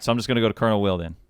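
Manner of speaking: ordinary delivery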